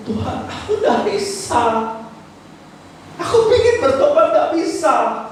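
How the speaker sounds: a believer confessing to God, mock-pleading; pleading prayer. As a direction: beg God like someone at the end of their strength. Voicing a repentant believer's prayer.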